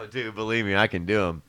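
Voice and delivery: ordinary talk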